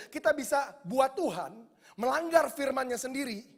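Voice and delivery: loud, rising preaching; preaching at full force. Acted. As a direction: drive the point home to the congregation. (berkhotbah dengan keras dan berapi-api)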